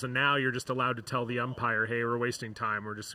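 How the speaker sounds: usual speaking voice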